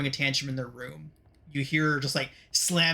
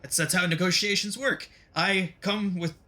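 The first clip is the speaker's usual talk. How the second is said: higher, stammering villain backpedal; flustered bargaining villain; 5 semitones higher than usual. Acted. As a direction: scramble to keep the deal alive. Villain scrambles to save the deal.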